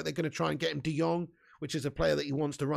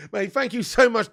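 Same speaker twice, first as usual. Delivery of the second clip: laughing; 5 semitones higher, 6 dB louder than usual. Real, not acted. Laughing at fan's title.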